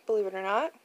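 ordinary delivery

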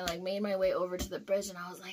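ordinary delivery

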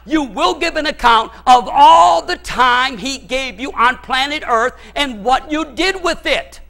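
loud, high fiery sermon voice; full-force preaching. Acted. Pastor warning the congregation of judgment, fiery preaching. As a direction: thunder it from the pulpit.

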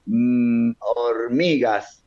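slow, careful recall of a tricky title; concentrado al decirlo. Real, not acted. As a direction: stretch it out while concentrating hard. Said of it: "me voy a concentrar para decirlo". (lento, concentrándose para decirlo)

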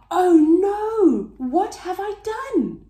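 high, dramatic storybook dismay; acted horror, pitched high. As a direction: exclaim in alarm at your own mistake. Norman realises presents are not his.

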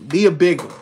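loud, punched-up plea for luck; will the ticket to win out loud. Real, not acted. Pleading with the last ticket.

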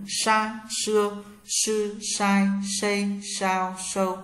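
"Sa, xưa, sư, sai, xây, sao, sâu" with tongue curled back; curled-tongue sh on "Sa, xưa, sư, sai, xây, sao, sâu", high level tone; retroflex sh. Shown as a demonstration. Teacher drills sh for learners, "Sa, xưa, sư, sai, xây, sao, sâu" with curled tongue. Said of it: "S H cong lưỡi".

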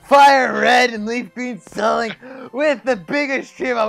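loud, pumped-up show opener; hype intro shout. Real, not acted. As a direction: shout it like a hyped host. Hyped show intro with music.

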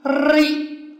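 ri with a long rolled r; doubled consonant. Shown as a demonstration. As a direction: roll and hold the r before the i in ri. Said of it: "叫叠音了".

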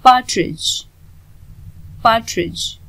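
पार्ट्रिज said with no D sound before the final j; silent D. Shown as a demonstration. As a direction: say पार्ट्रिज as "par-trij", the D silent. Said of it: "डी silent होगा".

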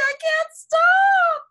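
squeaky strained character cry; frantic character voice. Acted. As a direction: whine it helplessly. Character can't stop snapping.

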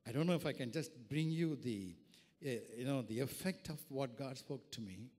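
normal talking voice

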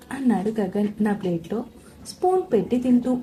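plain reading voice

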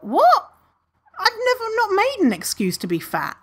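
high, stunned disbelief; incredulous. Retold or imitated. Thinking back to the doctor's remark, high incredulous disbelief. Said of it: "but I just thought".

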